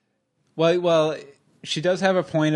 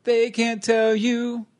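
slow, higher mock voice-over; 6 semitones higher, slower than usual. Dubbing a character's lines.

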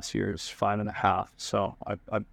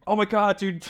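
amazed; 10 semitones higher than usual. Retold or imitated. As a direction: burst out in amazement at the fish. Sees the huge fish.

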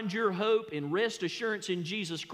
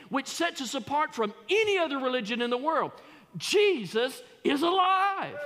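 preaching at full force; 4 semitones higher than usual. Acted. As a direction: lift the church with "Jesus is alive". Preaching builds to a climax.

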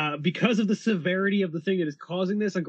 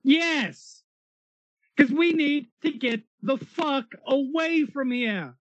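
urgent; 10 semitones higher, slower than usual. Acted. Character urging escape, high pitch.

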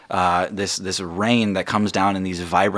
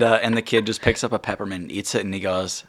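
normal talking voice

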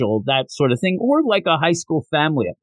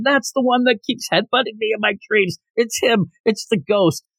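mock scream; 7 semitones higher than usual. Hanako recognizes the ghost from her dreams, mock screaming. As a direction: imitate a frightened cry at ordinary volume. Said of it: "where Hanako's screaming".

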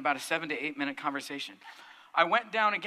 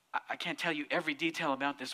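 normal talking voice

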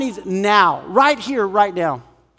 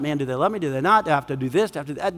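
low, soft string of worries; quiet, low inner monologue; muttered self-questioning; 6 semitones lower, 6 dB quieter, much faster than usual. Acted. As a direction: mull it over under your breath. Acting out anxious inner self-questioning.

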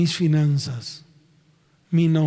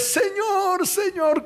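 very high, whimpering Señor; fake-sob the Lord's name; mock tearful plea; crocodile tears; 13 semitones higher, much slower than usual. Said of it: "con cara de cuchiflí y lágrimas de cocodrilo".

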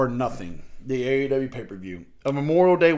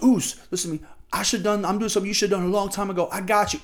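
higher-pitched, rushed retold plea; acted-out insistent reassurance, pitched up; urgent appeal; 6 semitones higher, faster than usual. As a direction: imitate someone urgently explaining himself, voice raised in pitch. Voices Jimmy pleading with his brother.

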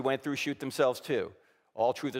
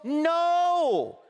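emphatic no; 6 dB louder than usual. Acted. Blunt answer to parody.

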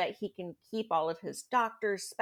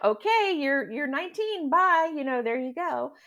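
higher, louder breezy dismissal; mock parental send-off; 6 semitones higher, 6 dB louder than usual. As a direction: lightly imitate parents waving a kid off. Mimics parents sending off a nineteen-year-old.

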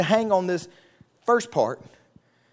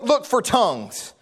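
usual speaking voice

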